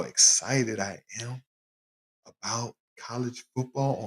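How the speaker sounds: hushed so the wife won't hear; whispered aside to the listeners; whispering. Real, not acted. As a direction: keep it secret, barely voiced. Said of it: "yeah, I'm whispering".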